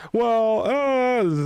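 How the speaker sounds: high, loud flustered stammer; retold speechless pause; startled hesitation. Stumped by the rich-get-richer point, high and flustered. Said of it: "And it's like".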